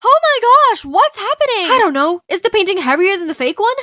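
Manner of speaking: loud, high-pitched alarm; panicked. Acted. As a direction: cry out as the alarm goes off. Alarm goes off during heist.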